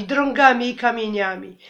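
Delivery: usual speaking voice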